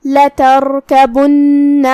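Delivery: لتركبن with heavy ra and doubled nun, very slow; slow tajweed demo. Shown as a demonstration. Teacher demonstrating the word, slow and heavy. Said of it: "ہمیں لام کو زبر سے ادا کرنا ہے".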